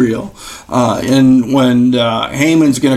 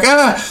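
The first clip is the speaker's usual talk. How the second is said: short high "ah" of dismay; a quick hammed-up yelp of alarm; little high cry of a man caught out; mock dismayed gasp; 10 semitones higher than usual.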